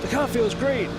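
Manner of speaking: "great" thrown up high and loud with sarcasm; sarcastic cheer. Pretend. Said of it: "which means it's probably slow".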